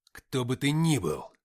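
loud, slow growl. A narrator's reading. Suvor growls at the possessed man, angry growl. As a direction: growl it through the threat. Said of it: "прорычал я".

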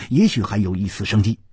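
usual speaking voice

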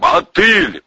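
loud, high shouted name; introducing himself. Acted. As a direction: call it up to the guards. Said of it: "представился Валерий".

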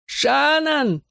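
very high, long-drawn call; yelling. A narrator's reading. Boy calling his girl from hospital bed, drawn-out yell. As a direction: holler the name, stretching the first vowel. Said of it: "он будет орать на всю больницу".

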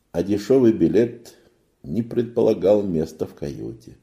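usual speaking voice

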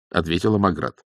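ordinary delivery, nothing marked

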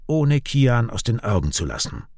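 ordinary delivery

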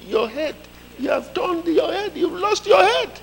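mock him in a squeaky rising voice; mock high voice. Coworkers mock Noah.

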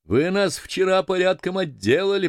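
high-pitched sailor's hail; voice from schooner. A narrator's reading. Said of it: "продолжал голос со шхуны". (высокий голос моряка со шхуны)

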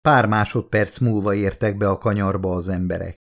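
usual speaking voice

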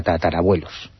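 ordinary talk, nothing marked

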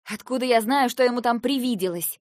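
loud, high indignant question; outraged, honest-sounding denial; sincere indignation. A narrator's reading. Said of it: "возмутилась я вполне искренне".

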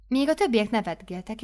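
plain reading voice

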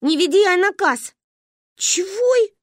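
высокий сердитый крик; раздражённо кричать из окна; сердито. Acted. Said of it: "рассердилась наша подруга". (сердито, громко)

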